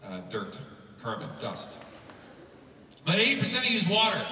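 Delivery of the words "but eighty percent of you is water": louder, faster, higher punch line; excited emphatic declaration. Real, not acted. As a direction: hammer the point home quickly.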